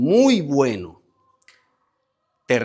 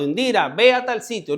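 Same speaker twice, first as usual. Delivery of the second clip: higher-pitched mock summons; demonstrated call; 9 semitones higher, 3 dB louder than usual. Shown as a demonstration. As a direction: send someone off with a raised call. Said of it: "no es que te hace un llamado".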